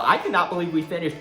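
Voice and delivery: normal talking voice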